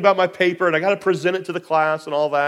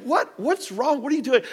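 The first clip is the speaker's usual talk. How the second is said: higher-pitched alarmed questions, lightly acted; lightly voiced startled wife; 7 semitones higher, 3 dB quieter than usual. Woken wife questions excited husband, lightly voiced alarm.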